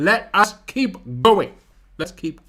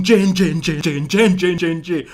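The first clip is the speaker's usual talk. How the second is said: imitated plane clatter in repeated beats; vocal sound effects; 4 dB louder than usual. Passengers telling family about the landing, vocal sound effects. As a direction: voice the plane's racket, punching each beat. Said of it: "sound effects are a big part".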